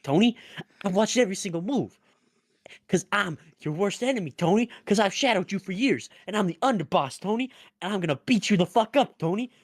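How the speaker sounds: put-on mob tough guy in a Jersey accent, high and fast; Italian-American Jersey accent. Underboss taunting the Don, high Jersey mob accent. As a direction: growl it like a Jersey mobster taunting. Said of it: "Your, your Italian accent".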